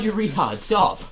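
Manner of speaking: usual speaking voice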